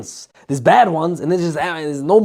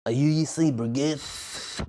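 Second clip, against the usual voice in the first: slow, soft imitation of a girl; slow, soft girl impression; mimicking a girl; 6 dB quieter, much slower than usual. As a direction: mimic her quiet question. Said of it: "It's exactly her voice".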